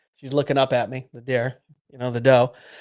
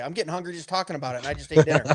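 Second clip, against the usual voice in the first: usual speaking voice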